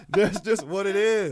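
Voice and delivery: talking through real laughter; laughing. Real, not acted. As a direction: laugh while getting words out. Laughing at himself.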